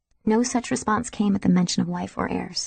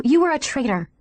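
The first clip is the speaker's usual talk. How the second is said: higher, hard and merciless; ruthless; 6 semitones higher, 3 dB louder than usual. A narrator's reading. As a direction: cut him down without pity. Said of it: "she said ruthlessly".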